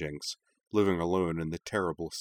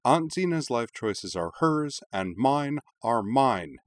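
firm anger; 6 semitones higher than usual. Acted. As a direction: shut the topic down hard. Heated argument with her mother.

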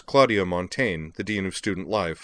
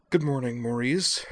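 harried; 6 semitones higher than usual. A narrator's reading. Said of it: "sounding as harried as she looked".

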